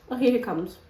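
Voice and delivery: normal talking voice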